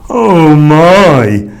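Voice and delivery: loud, drawn-out dismayed groan. A narrator's reading. Jonah sinking in the sea, groaning in dismay. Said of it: "groaned Jonah".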